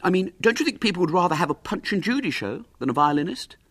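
lightly voiced high schoolboy; boy, tentatively persuasive. Boy nudging his mother toward Punch and Judy, tentative. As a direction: float the idea in a high voice. Boy suggests puppet show.

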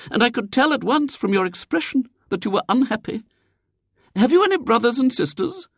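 very high, earnest lady's voice; a well-meaning lady probing for troubles; concerned lady. A narrator's reading. Lady questions William about his home, concerned and high.

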